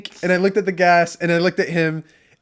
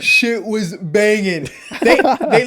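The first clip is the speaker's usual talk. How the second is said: laughing; 5 semitones higher, 5 dB louder, much slower than usual. Real, not acted. Laughing about the party.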